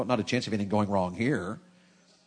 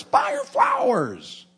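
soaring much higher and louder, very slow; exhorting shout; 6 dB louder, much slower than usual. Real, not acted. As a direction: cry it out to the husbands. Preacher exhorting husbands.

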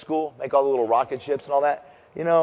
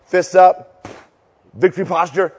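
coach calling out; 3 semitones higher, 6 dB louder than usual. Shown as a demonstration. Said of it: "Here we go".

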